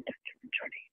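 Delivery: barely voiced whisper of an apology; whispered sorry. Real, not acted. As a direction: breathe the sorry out, almost silent. Apology after upsetting messages. (ফিসফিস করে দুঃখপ্রকাশ)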